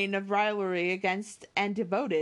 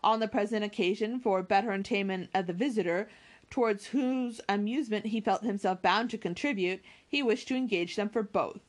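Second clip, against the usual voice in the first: plain reading voice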